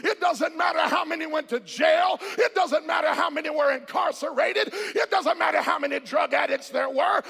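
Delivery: rousing, driving sermon delivery; full-force preaching. Real, not acted. Preacher urging the church to praise God, full-force preaching. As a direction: preach it out to a congregation.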